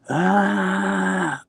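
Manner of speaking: high, drawn-out mock scream; imitated scream. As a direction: imitate a sleeper's long high wail. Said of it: "So he stopped screaming".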